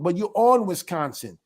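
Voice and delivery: normal talking voice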